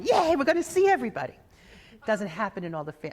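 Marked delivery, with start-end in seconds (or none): 0.0-1.3 excited cheer. Retold or imitated. Family celebrating a death.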